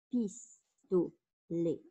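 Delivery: "Pistolet" slowly in three beats, pis-to-let; split into syllables. Shown as a demonstration. Teacher counting syllables for pupils, "Pistolet" split as pis-to-let. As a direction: sound out "Pistolet" with a pause after each syllable. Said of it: "trois syllabes".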